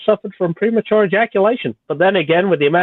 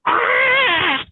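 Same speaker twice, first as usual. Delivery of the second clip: just hit in the groin. Pretend. Mock scream at the nail clipper threat.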